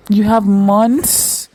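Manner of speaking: high, loud, breaking plea; a pained high outcry near tears; anguished cry. Real, not acted. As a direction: wail it out as the news hits. Reacting to a character dying.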